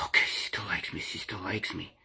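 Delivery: hushed private whisper of relief; whispered to himself. Retold or imitated. Telling himself his girlfriend still likes him, whispered relief. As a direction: whisper it like a secret thought. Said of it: "I'm like".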